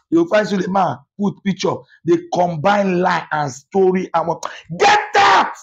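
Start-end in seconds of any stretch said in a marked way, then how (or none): 4.8-5.5 furious Get out; shouted dismissal. Real, not acted. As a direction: bark them away. Angry rant at a rival.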